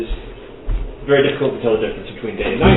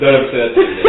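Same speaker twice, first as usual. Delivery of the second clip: laughing; 2 semitones higher, 7 dB louder, much faster than usual. Real, not acted. Laughing mock scolding.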